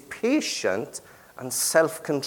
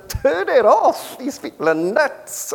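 high, loud, slow mock-wife voice; a high put-on wife telling him to turn it off, stretched out; imitating his wife; 12 semitones higher, 4 dB louder than usual. Husband mimics his wife demanding he switch off the radio, high and loud. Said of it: "No wonder Hannah says".

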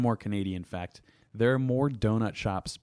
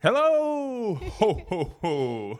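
booming high Santa ho ho ho; Santa laugh; 12 semitones higher, 5 dB louder, much slower than usual. Pretend. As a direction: play Santa calling from his sleigh. Santa sailing through the sky.

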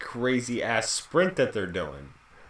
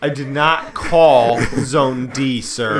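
stern gate agent; 7 dB louder than usual. Pretend. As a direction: scold the passenger.

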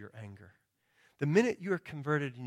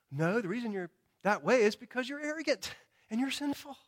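higher, pointed correction; firm rebuttal; 9 semitones higher than usual. Acted. Preacher answers the excuse-maker directly, firm rebuke. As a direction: cut the excuse down flatly.

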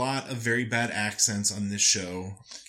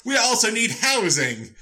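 drawn-out, high-pitched mock call; mock announcing; 13 semitones higher, 6 dB louder, slower than usual. Castaway wandering about stating needs, loud mock announcing. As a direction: holler it slowly, playing the useless leader. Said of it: "I would just walk around saying".

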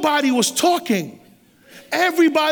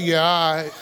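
unimpressed; 7 semitones lower than usual. Retold or imitated. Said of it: "looking at me like this".

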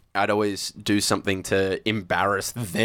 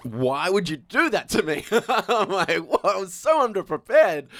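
higher, laughing mock whine; a laughing, hammed-up wail of protest; laughing complaint; 9 semitones higher than usual. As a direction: whine it through laughter at your mentor. Complaining to Andrew G after panicking.

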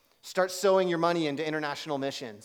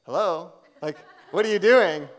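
loud, incredulous hello, what are you doing; God prodding; 3 semitones higher, 11 dB louder than usual. Retold or imitated. God nudging the speaker to pray for her, loud incredulous prod. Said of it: "and God's like".

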